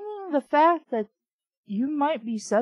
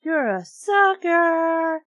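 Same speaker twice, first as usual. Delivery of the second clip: loud, slow drawn-out taunt; gleeful exclaim; 5 semitones higher, 6 dB louder, slower than usual. A narrator's reading. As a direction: stretch out the gotcha, loud. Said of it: "I exclaimed and burst out laughing".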